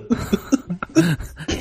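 short soft chuckle; soft laugh. Real, not acted. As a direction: chuckle quietly. Laughs at the Playmobil joke.

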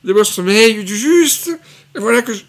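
plus aigu et plus fort, réveil hébété; Galabru au paradis. Acted. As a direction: jouer un vieil homme qui se réveille perdu. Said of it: "Je voulais faire Galabru qui était accueilli par De Funès". (Galabru perdu au paradis)

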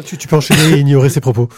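ordinary talk

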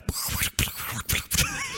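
wet lip-bubbling gurgle of running water; imitated water noise. Shown as a demonstration. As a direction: blow bubbly lips to mimic pouring water. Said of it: "Au lieu de faire".

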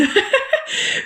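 petit rire aigu et soufflé, gêné. Real, not acted. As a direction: rire en soufflant, amusée d'elle-même. Rit de son manque d'expertise. (rire gêné aigu)

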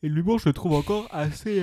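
yawning. Real, not acted. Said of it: "pardon, je baille".

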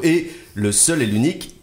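big build to the name, slowing down; showman announcement. Acted. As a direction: announce him like a game show host. Host announces returning guest.

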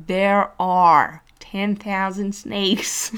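very slow, loud American accent. Shown as a demonstration. Teacher hamming an American accent for her class. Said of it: "Ou à l'américaine".

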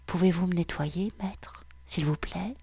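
whispered, submissive plea; pleading whisper. Acted. Woman whispering her plea to her master, submissive whisper. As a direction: beg softly under the breath.

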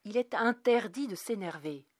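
firm, controlled fatherly order; calm tone. A narrator's reading. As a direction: lay down the rule without shouting. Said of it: "avait-il dit d'un ton calme".

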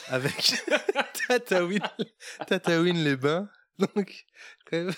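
giggling through the place names, slow; laughing. Real, not acted. Narrator reading a tale to friends, laughing.

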